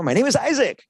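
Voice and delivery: bright emphatic "My name is Isaac!"; exclamation ending. Shown as a demonstration. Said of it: "exclamation point".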